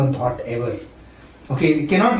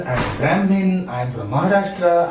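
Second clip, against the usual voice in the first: ordinary talk, nothing marked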